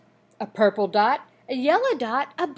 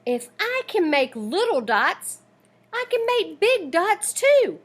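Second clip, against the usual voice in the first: rising higher and louder with excitement; excited; 6 semitones higher, 5 dB louder than usual. A narrator's reading. Girl delighted to discover she can paint big dots, excited. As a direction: build up to a thrilled "big dots too".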